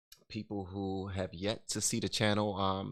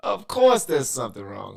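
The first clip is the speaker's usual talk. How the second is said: much louder, ranting outburst; exasperated shout; 5 semitones higher, 14 dB louder than usual. Real, not acted. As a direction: yell it out in frustration. Stream audio breaking, exasperated.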